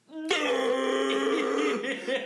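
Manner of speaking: high, loud burst of real laughter. Real, not acted. Laughing while retelling.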